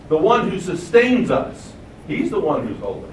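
normal talking voice

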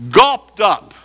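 high, loud punch on Gulped up; forceful preaching. Real, not acted. Preacher rephrasing scripture for listeners, loud punch. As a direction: throw Gulped up out hard.

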